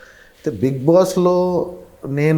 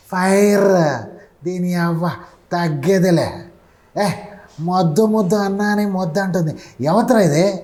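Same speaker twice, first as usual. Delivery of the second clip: higher rough Pushpa swagger; Allu Arjun impression; 6 semitones higher than usual. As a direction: growl out the Pushpa dialogue with attitude. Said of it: "అదే అల్లు అర్జున్ మీద".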